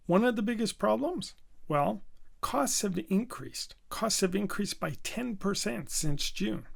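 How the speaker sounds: "One of the biggest problems?" asked on a rise, then "costs have increased" answered firmly; rhetorical question phrasing. Shown as a demonstration. Presenter demoing conversational phrasing, question then answer. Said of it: "rhetorical question".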